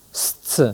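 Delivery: st hissed without voice; st sound. Shown as a demonstration. Teacher isolating the st sound. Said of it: "le son".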